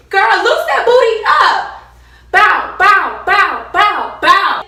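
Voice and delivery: loud, high, excited hype call. Real, not acted. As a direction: cheer them on like a dance coach. Hyping student to loosen up.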